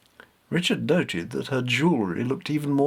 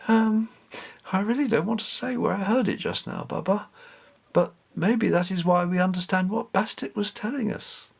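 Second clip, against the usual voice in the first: put-on a higher child's voice, a little evasive; shy boy avoiding the question; hesitant young boy; 9 semitones higher than usual. Richard answers hesitantly.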